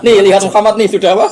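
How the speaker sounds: loud, high caricature of an inciter; mock disrespect. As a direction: taunt the crowd at full volume, play-acting. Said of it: "Orang kok ndak sopan seperti itu". (meniru orang tak sopan, lantang)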